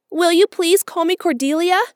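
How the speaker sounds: put-on high, loud, eager girlish plea. A narrator's reading. Orphan girl to Marilla asking for a new name, eager and high. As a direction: ask hopefully like a dreamy child. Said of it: "she said eagerly".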